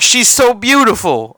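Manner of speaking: high, doting praise; gushing. Retold or imitated. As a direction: dote on the baby out loud. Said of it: "He's like".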